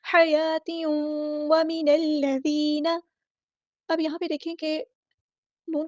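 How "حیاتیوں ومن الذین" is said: حیاتیوں with ghunna held one alif before و; slow nasalized merge in حیاتیوں; tajweed recitation. Shown as a demonstration. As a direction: hum the tanween into و, slowly. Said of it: "ادغام ناقص ہوگا".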